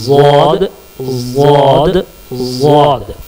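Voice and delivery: वाद from the tongue edge against the jaw roots; letter demo. Shown as a demonstration. Teacher demonstrating the letter for students, side of tongue. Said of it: "वाद निकलेगा".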